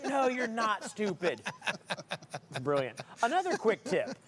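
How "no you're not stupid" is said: higher, slow calming voice; soothing wife. Retold or imitated. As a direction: gently reassure him it's fine. Wife reassuring him.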